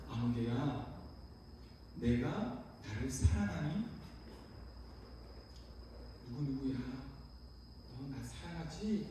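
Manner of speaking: very soft, low and slow calling; hushed, loving voice of Jesus; gentle voice. Acted. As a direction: ask tenderly, leaving long pauses. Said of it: "부드러운 음성으로 다시금 우리를 부르신다".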